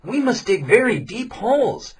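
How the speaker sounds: put-on young man explaining the trap to villagers; young man's voice. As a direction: lay out the plan clearly. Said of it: "said the young man".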